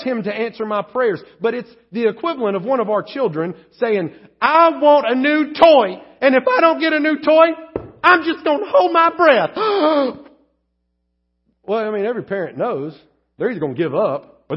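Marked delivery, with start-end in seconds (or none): 4.4-9.5 put-on higher and louder, demanding kid; whiny child. Child demanding a new toy from parent, whiny. 9.6-10.2 gasp in. Retold or imitated. Child holds breath.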